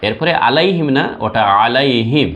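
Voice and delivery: normal talking voice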